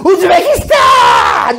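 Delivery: high, drawn-out holler; hammed-up high, slow shout; demonstrated yell. Shown as a demonstration. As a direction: scream the country name as a wish. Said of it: "이렇게 소리를 질러".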